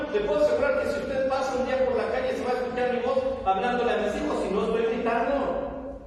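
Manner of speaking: usual speaking voice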